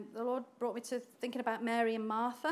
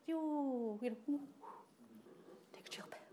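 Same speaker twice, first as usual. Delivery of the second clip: softer, fading to a whisper; calming; 10 dB quieter than usual. Acted. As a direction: hush her gently down. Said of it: "he's calming her down".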